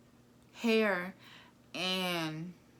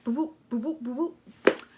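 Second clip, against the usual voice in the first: mock fight noises, louder and higher; imitated punches; 5 semitones higher, 6 dB louder than usual. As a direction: punch sounds in a playful voice. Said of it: "She's like".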